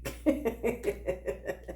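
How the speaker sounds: soft run of giggles; quiet wordless chuckling; real chuckle. Real, not acted. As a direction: laugh softly to yourself. Laughs at own story.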